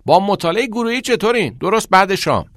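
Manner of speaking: high, loud call to friends; shouting. A narrator's reading. Boy invites friends to study group, shouting. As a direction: shout it out from under the shower. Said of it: "میکس از زیر دوش داد زد".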